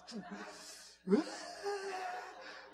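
high, laughing sob; imitated sobbing. Preacher replaying the message, high tearful wail. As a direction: act out a high, helpless cry. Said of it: "Just tortured my emotions".

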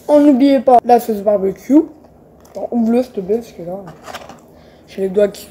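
chewing while talking; eating fries; mouth full. Real, not acted. Seen 1: Bites a fry held at mouth at the start, then looks down.

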